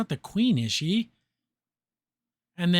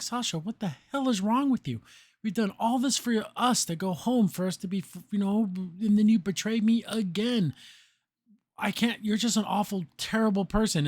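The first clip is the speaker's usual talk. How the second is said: retold anger, pitched higher; mild mock outrage in a raised pitch; pissed off; 5 semitones higher than usual. Anne telling off Sasha for betraying her, pissed off. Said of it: "there's Anne pissed off".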